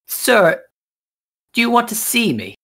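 put-on high, quick girlish question; princess voice. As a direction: lift it up into a dainty ask.

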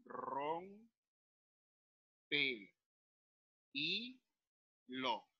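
ROMPEHILO in four soft, stretched syllables: rom, pe, i, lo; quiet, slow syllable division of "rompe y lo". Shown as a demonstration. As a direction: say "rompe y lo" with a long pause after each syllable. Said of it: "debo separarlo, digo".

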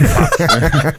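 high, loud curse breaking into a laugh. Retold or imitated. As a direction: swear as you crack up. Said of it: "I'm like".

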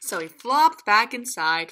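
ordinary delivery

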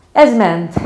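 usual speaking voice

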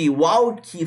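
"Walk" as "walkee", Brazilian style; added final vowel. Shown as a demonstration. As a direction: tack an ee onto the k of "Walk". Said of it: "você estende essa vogal". ("Walk" com i depois do k)

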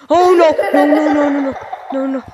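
sudden scared yell; panicked shouting. Real, not acted. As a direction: yell in fright. Monster appears again.

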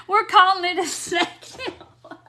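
words through real laughter; laughing while she talks. Real, not acted. Host reading chat jokes aloud, laughing.

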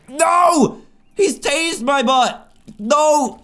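panicked yelling. Real, not acted.

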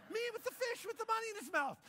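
very high, quick mock squeal; hammed-up giddy excitement. Disciple taking a selfie with the fish, hammed-up giddy squeal. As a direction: gush it like a starstruck selfie-taker.

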